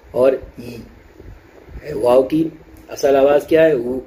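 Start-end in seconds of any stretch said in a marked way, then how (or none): 0.6-1.9 ای as a long, pure "ee"; base yaa sound. Shown as a demonstration. Teacher isolates the yaa's root sound, ای as pure ee. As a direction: hold ای as a drawn-out ee. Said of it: "جو جڑ ہے اور بنیاد ہے".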